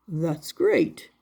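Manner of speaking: kindly maker, voiced low; Eli the maker. Woodcarver answering his little puppet, low and kind.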